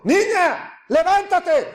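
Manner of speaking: high, drawn command in a great voice; loud commanding cry. Retold or imitated. The Messiah to Jairo's dead daughter, crying out. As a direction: call out as if raising the dead. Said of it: "habló a gran voz, gritó diciendo".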